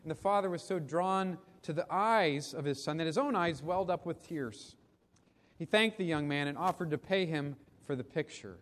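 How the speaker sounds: ordinary delivery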